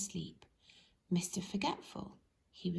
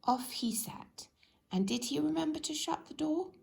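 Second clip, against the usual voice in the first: plain reading voice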